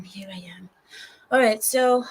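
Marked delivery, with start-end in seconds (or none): 0.0-0.7 whisper. Acted. Greeting viewers after camera setup.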